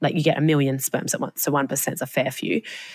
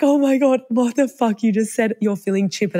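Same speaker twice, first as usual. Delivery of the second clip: shocked excitement; 6 semitones higher than usual. Retold or imitated. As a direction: blurt it out like it's a sign. Sister used the chosen name.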